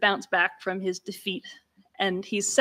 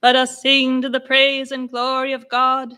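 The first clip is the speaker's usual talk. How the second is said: loud, level announcing of the psalm; a hint of a church clerk's chant; parish clerk monotone; 4 semitones higher, 7 dB louder than usual. Shown as a demonstration. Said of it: "like the proclamation of a parish clerk".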